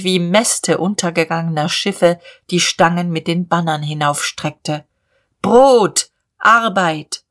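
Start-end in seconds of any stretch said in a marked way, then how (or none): 5.4-7.2 loud, drawn-out protest cry; slogan called over a crowd; crowd shouting. Acted. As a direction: chant it out like a demonstrator. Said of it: "Ein deutlicheres Grollen".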